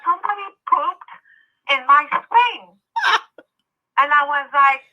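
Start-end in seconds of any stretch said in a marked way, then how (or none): 0.0-2.8 put-on higher childlike voice, slowing right down on swing; little boy voice, repeated. Repeats boy's line for effect.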